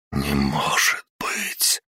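soft, slow dying whisper. Acted. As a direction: breathe it out as he dies. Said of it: "и прошептал". (шёпот умирающего)

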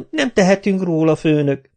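high, whining plea to the boss. A narrator's reading. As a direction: whimper it up high like a scared lackey. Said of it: "siránkozott Kapa".

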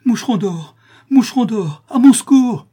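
loud, high scream for help; near-delirious cry. A narrator's reading. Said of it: "cria le pauvre Gringalet presque en délire".

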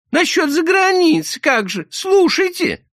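very high, loud insistent voice; визгливая Шура. A narrator's reading. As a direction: plead urgently in a squeal. Shura grabs him, pleading. (настойчивый визгливый голос)

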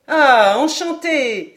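loud, high, drawn-out greeting; delighted exclamation. A narrator's reading. Stranger greeting the captain, delighted exclamation. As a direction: stretch it out with hearty delight. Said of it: "s'écria l'inconnu".

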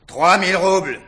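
put-on loud drunken taunt. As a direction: shout the offer out. Repeating the bid.

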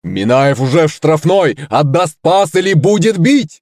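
high, rising commentator voice; shouted match commentary. A narrator's reading. Little monster commentating final seconds, high excited shout. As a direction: call the attack excitedly, pitch climbing. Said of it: "закричал Кузьма".

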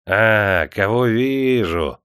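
slow, drawn-out syrupy voice; sugary greeting. A narrator's reading. Clerk spots Ivashka in the crowd, sugary. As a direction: stretch it out with false sweetness. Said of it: "слащавым голосом окрикнул он".

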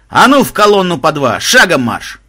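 loud drill command; marching order. A narrator's reading. Game master sending the players into the forest, loud command.

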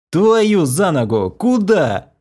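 slow, high angry growl. A narrator's reading. Narrator catching a falling girl, growling angrily. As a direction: snarl it as she slides down the stairs. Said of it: "рыкнул я".

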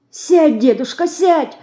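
put-on high, remorseful girl's urging. A narrator's reading. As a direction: urge grandpa to sit, anxious and sorry. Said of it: "с раскаянием бормотала она".

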